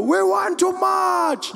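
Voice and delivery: high, drawn-out crowd shout; long fervent chant-back; riled-up supporters. Retold or imitated. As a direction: answer like a roaring rally. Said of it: "how riled up his supporters were".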